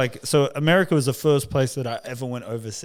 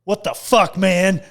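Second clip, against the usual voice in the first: higher-pitched imitation of an irate man; mock indignant outburst; 5 semitones higher, 3 dB louder, slower than usual. Huge traveller objecting in the security line, mock angry. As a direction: act out an affronted complaint.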